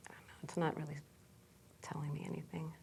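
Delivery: faint, hushed aside; barely voiced, still listening inward; whispering. Real, not acted. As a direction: trail into a near-silent whisper. Client reports silence while listening inwardly.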